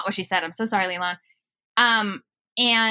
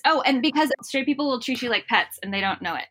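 normal talking voice

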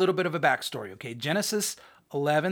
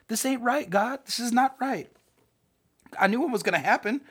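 indignant rant; 8 semitones higher than usual. Acted. Jonah ranting at God.

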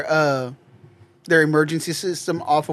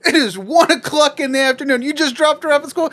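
high, disbelieving question; incredulous cashier; 8 semitones higher than usual. Retold or imitated. Cashier to customer with daughter, high incredulous.

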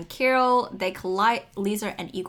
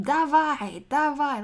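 mock yell, higher and louder; retold coach's shout, pitched up; imitated coach scream; 5 semitones higher, 4 dB louder, slower than usual. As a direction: holler it like a coach from the boards. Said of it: "just scream".

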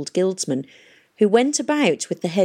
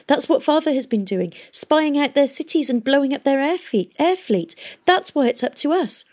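high, insistent rush of argument; urgent, agitated explanation; impassioned urgency; 9 semitones higher than usual. A narrator's reading. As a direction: press the case, pitch held well up. Heated explanation by Catherine.

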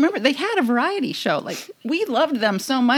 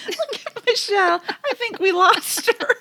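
laughing; 9 semitones higher than usual. Real, not acted. Host laughing as Michelle's video freezes. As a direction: giggle through it.

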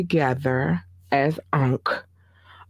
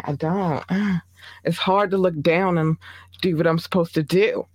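usual speaking voice

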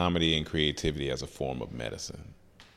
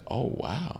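soft, higher-pitched "oh, wow"; hushed, rising wonder; quiet amazement; 7 semitones higher, 7 dB quieter than usual. Retold or imitated. Retold reaction to survivor's news.

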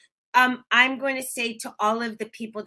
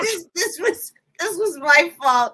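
hammed-up tearful wail, higher and slower; half-joking sobbing apology; mock wail; 7 semitones higher, 4 dB louder than usual. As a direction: overdo the weepy confession. Mock-tearful apology for crashing stream.

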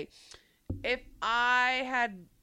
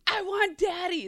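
put-on whiny toddler; 8 semitones higher, 5 dB louder than usual. Toddler crying for dad.

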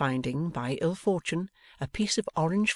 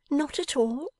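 put-on much higher, rising questioning girl's voice; surprised Bella; 12 semitones higher than usual. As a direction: Bella echoes his words in disbelief.

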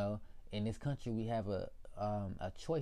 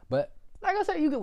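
high yelp; 9 dB louder than usual. Real, not acted.

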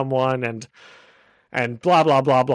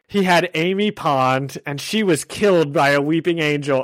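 ordinary delivery

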